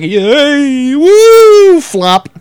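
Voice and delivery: high squealing yay woo then a flat flop; mock cheer. Belly doing the wave, squealing cheer. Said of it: "It was just like".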